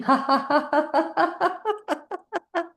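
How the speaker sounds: high ha-ha laughter; exit-row laughter exercise; forced laughter. Shown as a demonstration. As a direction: laugh hard on purpose. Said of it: "having that drink with laughing".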